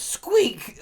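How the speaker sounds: piercingly high, loud squeal; yelled squeak. Acted. Squirrel hammer answers Loki, yelling a squeak. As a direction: yelp it like a squirrel. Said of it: "And Ragnar yells".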